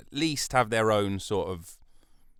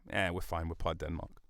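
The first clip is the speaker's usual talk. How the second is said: mock indifference; 5 semitones lower, 6 dB quieter than usual. Voices indifferent Greenlanders.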